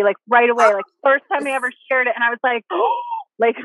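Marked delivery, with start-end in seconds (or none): 2.7-3.3 high, sharp gasp of shock; sudden mock-horrified intake of breath. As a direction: gasp as if stunned by an accusation. Acts out shock at accusation.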